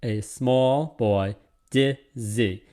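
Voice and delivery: "dizzy" split into two slow beats, DIZ, ZY; very slow, each beat set apart, "dizzy" broken in two; broken-up scansion. Shown as a demonstration. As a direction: scan it slowly, pausing inside "dizzy". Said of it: "It's broken up".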